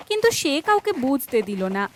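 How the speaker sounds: usual speaking voice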